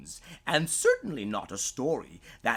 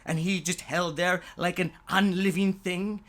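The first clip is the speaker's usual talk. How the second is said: ordinary delivery, nothing marked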